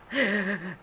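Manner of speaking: a short real laugh at the memory. Real, not acted. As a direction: burst out laughing briefly. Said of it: "and I started laughing".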